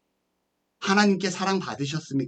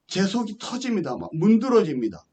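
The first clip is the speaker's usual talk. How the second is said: ordinary delivery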